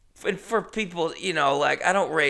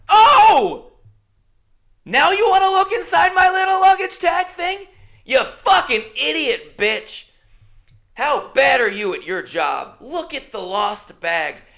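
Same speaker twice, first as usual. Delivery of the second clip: much higher and louder, outraged; outraged rant; 12 semitones higher, 8 dB louder than usual. Retold or imitated. To the worker asking his phone number: outraged shouting. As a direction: explode at her incompetence.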